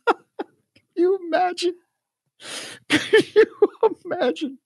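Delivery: high, squeaky laugh through the words; laughing disbelief. Real, not acted. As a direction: giggle out your disbelief.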